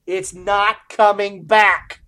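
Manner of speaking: loud, high, drawn-out warning; emphatic warning. Real, not acted. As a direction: hammer it home slowly, word by word. Mock warning about the belt.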